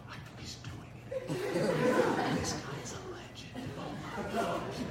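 slow hushed whisper of amazement; awed whisper. Retold or imitated. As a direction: whisper like classmates in awe. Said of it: "They're like".

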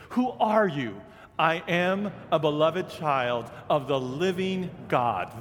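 emphatic, ringing declaration; with conviction. Shown as a demonstration. Preacher leading the congregation in repeating it, with conviction. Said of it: "Say it with conviction".

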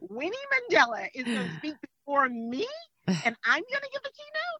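retold panic at speaking after Winnie Mandela; incredulous. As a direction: rise in comic disbelief. Disbelief at following Winnie Mandela.

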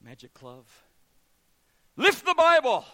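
hammed-up incantation; grand magic command. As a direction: play the showman magician. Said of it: "Let's try this again".

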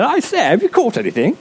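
high, plummy English gent calling out; mock upper-class English accent; posh English accent. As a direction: hail the boat like a posh English gentleman. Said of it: "This guy on the shoreline said".

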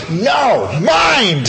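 high, loud corrective shout; teacher's shout. Retold or imitated. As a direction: bark the word mind up high like a frustrated teacher. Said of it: "And he goes".

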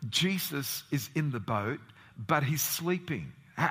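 normal talking voice

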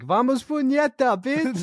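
high-pitched hype holler; retold party yell, not full volume; mock scream. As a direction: crow it way up high, stretched out. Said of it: "I just kept screaming that".

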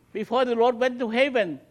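ordinary talk

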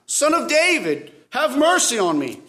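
high, insistent plea for mercy; crying out. Acted. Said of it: "He keeps on crying out".

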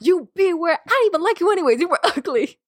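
high-pitched sore-loser retort, laugh breaking in; mock wounded rejection. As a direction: play a rejected guy lashing out, hammed-up and high. Acting rejected bar guy from skit.

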